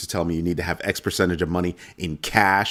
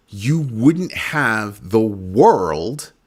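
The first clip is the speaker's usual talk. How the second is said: slow emphatic; 3 semitones higher, 4 dB louder, much slower than usual. Real, not acted.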